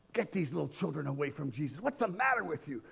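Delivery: low, hushed scolding; rebuking. Acted. Crowd leaders rebuking mothers with children, hushed telling-off. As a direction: scold under your breath. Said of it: "They were rebuking the mothers".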